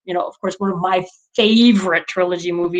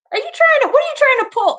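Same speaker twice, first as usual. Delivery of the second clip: mock accusing; 4 dB louder, faster than usual. Retold playful accusation.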